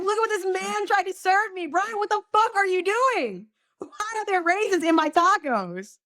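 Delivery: high, loud mock-indignant rant; mock outrage. Acts out outraged livestream rant.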